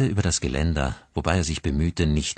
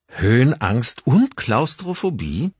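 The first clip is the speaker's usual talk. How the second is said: slow, higher-pitched mock amazement; grinning teasing; 6 semitones higher than usual. A narrator's reading. Colleague to Grace about his fears, grinning and teasing. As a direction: tease with a grin, drawing out the questions. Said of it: "fragte sein Kollege grinsend".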